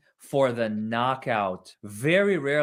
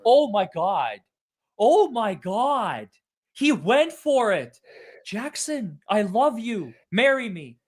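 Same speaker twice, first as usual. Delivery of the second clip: loud, high, stunned delight; can't believe he went for it; swooning over the lawyer; thrilled gushing; 7 semitones higher, 4 dB louder, slower than usual. Real, not acted. Gushing at the cross-examination.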